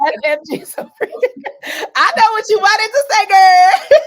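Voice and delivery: high, loud shout through laughter; laughing hard. Real, not acted. Host teasing her cohost over a slip, laughing hard. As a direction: shriek it out, helpless with laughter.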